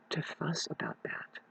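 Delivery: trailing into a whisper. Real, not acted. Vlogger whispers about mountain life. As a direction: drop to a whisper.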